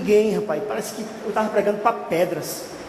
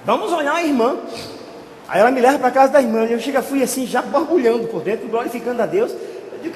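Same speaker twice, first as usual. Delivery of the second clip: ordinary talk, nothing marked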